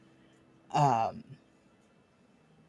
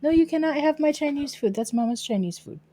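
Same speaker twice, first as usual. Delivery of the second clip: higher sing-song pet talk; 5 semitones higher, 7 dB louder than usual. Real, not acted. Owner doting on her dog at her desk. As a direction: dote on the dog playfully.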